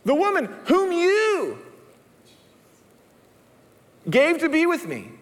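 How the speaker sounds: Adam pleading as he shifts the blame; Adam blaming God. Acted. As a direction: point the finger back at God. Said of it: "He, he blames God".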